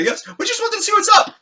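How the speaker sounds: imitating an excited fan, very high and loud; mock over-eager reply; eager blurt. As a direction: pipe up right away, high-pitched. Friend's eager answer to star.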